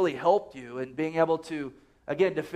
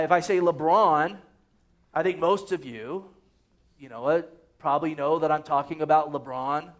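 ordinary delivery

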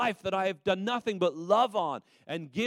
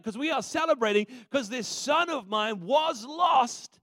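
joyful commanding shout; 5 semitones higher than usual. Acted. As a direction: call out the feast plans with overflowing joy. Father orders feast, joyful.

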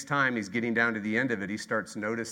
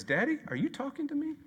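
put-on high, small child voice; lightly voiced puzzled little boy; 14 semitones higher than usual. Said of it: "the boy says".

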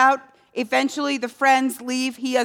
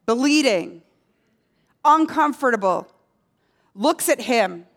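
usual speaking voice

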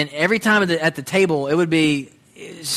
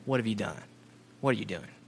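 the question hushed and much softer, almost breathed; soft reverent quote; 6 semitones lower, 11 dB quieter, faster than usual. Retold or imitated. Preacher reciting scripture's question back to God, softly. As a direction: let the words fall away in awe.

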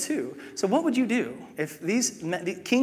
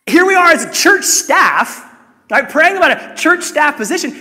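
exclaiming; 11 semitones higher, 13 dB louder than usual. Real, not acted. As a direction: shout the irony at the room. Exclaiming the irony aloud.

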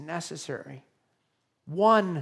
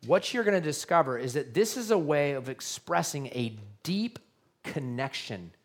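ordinary talk, nothing marked

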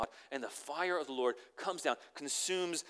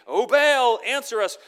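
mock crying out; 9 semitones higher, 12 dB louder, slower than usual. Prophets crying out to Baal.

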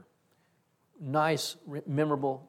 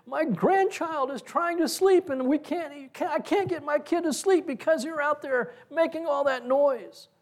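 imitated screaming complaint; 12 semitones higher than usual. Pastor's wife blaming noise for sleepless grandchild, imitated high complaint. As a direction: act out an upset neighbor, pitched way up. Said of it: "and she's screaming".

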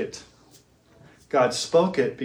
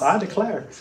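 Southern drawl; 5 semitones higher than usual. Retold or imitated. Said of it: "like in the South".

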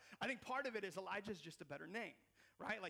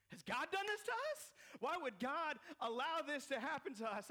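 mock accusing cry to God; 7 semitones higher, 4 dB louder than usual. Israelites blaming God in Bible story.

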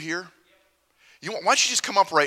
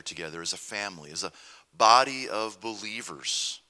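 soft, reverent prayer voice; 6 semitones lower, 6 dB quieter than usual. Acted. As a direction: pray quietly and humbly. Opening prayer to the Lord.

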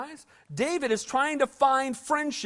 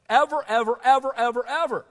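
louder, pounding "ever" again and again; insistent hammered "ever"; emphatic repeated ever; 5 dB louder than usual. Real, not acted. Emphatic repetition of never.

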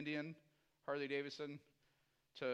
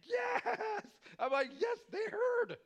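high, loud, excited yes; overjoyed shout of yes; jubilant cheer; 8 dB louder, much slower than usual. Retold or imitated. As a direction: cheer it like great news.

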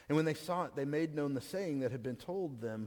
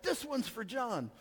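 mock scream; 8 semitones higher, slower than usual. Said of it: "he just screamed out".

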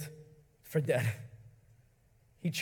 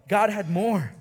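ordinary talk, nothing marked

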